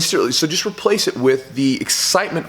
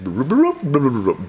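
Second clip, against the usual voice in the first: imitated bobbing underwater; slower than usual. Kid going under after screaming, comic drowning noises. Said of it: "back up and down".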